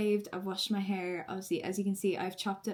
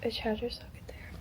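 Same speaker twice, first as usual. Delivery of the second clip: slow nervous whisper; whispering on the phone; 3 semitones higher, 6 dB quieter, slower than usual. Real, not acted. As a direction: whisper softly on the ward. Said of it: "I have to whisper".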